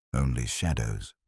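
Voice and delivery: low, soft fisherman's reply; a hushed, guarded fisherman. A narrator's reading. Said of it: "he said".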